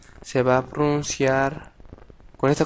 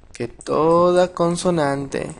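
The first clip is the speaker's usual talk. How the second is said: "toda" drawn out and raised, slow; slow emphatic rule; 4 semitones higher, 3 dB louder, much slower than usual. Shown as a demonstration. Teacher restating the rule to students, slow stress on "toda". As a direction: spell the rule out slowly, leaning on "toda". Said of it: "teacher stresses rule again".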